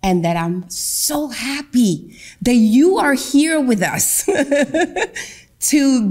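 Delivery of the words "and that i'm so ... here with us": usual speaking voice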